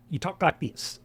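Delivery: quick, clipped Appalachian twang through the nose. Shown as a demonstration. Explaining his home accent, a quick Appalachian demo. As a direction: show the clipped mountain Appalachian way of talking. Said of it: "And it's clipped".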